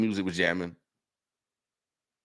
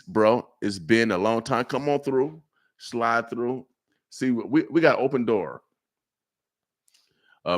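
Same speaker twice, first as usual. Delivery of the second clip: usual speaking voice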